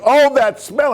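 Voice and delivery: high mock passenger whine; complaining woman. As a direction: squeal it in disgust. Woman still complaining on plane.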